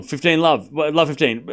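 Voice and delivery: normal talking voice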